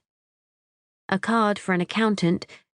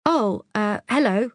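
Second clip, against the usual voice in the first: stammering; 4 semitones higher than usual. A narrator's reading. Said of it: "I stammer".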